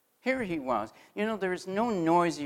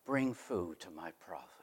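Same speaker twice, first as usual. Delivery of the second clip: soft inner voice whispering an instruction; quiet still voice; 9 semitones lower, 11 dB quieter than usual. Acted. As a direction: speak it like a still small voice within. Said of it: "that quiet, still voice".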